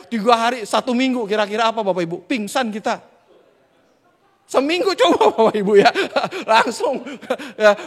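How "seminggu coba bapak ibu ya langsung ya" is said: laughing. Real, not acted. Laughing at fainting punchline.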